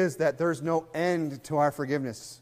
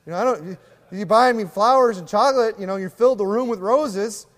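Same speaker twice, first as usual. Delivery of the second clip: higher, louder nagging rant; exasperated wife; 6 semitones higher, 6 dB louder than usual. Retold or imitated. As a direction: scold the lazy husband, fed up. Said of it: "She's like".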